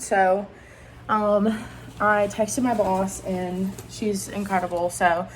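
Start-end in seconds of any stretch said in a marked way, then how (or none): none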